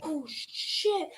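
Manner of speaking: a hushed, unvoiced "oh, shit"; whispered curse. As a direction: swear under your breath in alarm. Realizing brother is coming.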